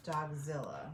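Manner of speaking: ordinary delivery, nothing marked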